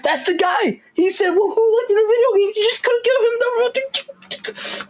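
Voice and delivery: very high, slow exasperated fan rant; excited fan outburst. Retold or imitated. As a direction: squeal it like a frustrated fan. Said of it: "And then I'm like".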